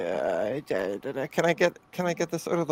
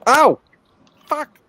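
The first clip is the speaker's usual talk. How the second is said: sharp loud cry of real pain; pained yelp; 9 semitones higher, 10 dB louder than usual. Real, not acted. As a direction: yelp as the hot iron bites. Burns finger on soldering iron.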